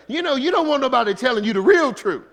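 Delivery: real truth, whole truth, pushed out loud and high; loud preaching climax. Real, not acted. Preacher to congregation, rising loud emphatic shout. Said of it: "preacher builds to loud climax".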